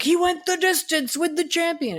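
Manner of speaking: high, strained excited commentator call. Retold or imitated. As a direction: imitate a wrestling announcer losing it over the result. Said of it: "J R was like".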